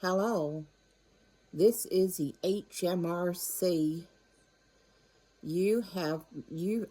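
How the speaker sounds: slow automated phone announcement, evenly spoken; deliberately slow mock robocall; recorded scam message. Scammer's recording claiming to be the tax office, slow announcement. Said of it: "it goes something like this".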